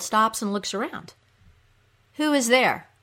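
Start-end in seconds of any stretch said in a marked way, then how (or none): 2.2-2.9 raised, loud regal challenge; demanding. Acted. Queen of the Underworld to hidden creatures, demanding. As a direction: demand an answer from unseen visitors. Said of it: "she demands".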